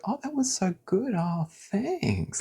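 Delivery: very high, breathy mock compliment; hollow sugary approval; fake praise. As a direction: imitate insincere gushing. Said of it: "on the surface, they'll be like".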